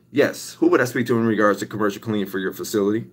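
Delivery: soft, quick cold-call patter; cold-calling a dental office, soft and quick; phone sales pitch. Shown as a demonstration. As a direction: rattle off the pitch smoothly and politely. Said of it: "that's just a rough draft, kinda how it would go".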